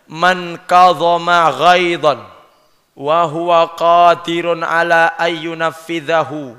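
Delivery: slow, measured Arabic recitation; hadith recitation. Acted. Preacher quoting the Prophet to the congregation, slow recitation. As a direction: recite with drawn-out syllables, evenly.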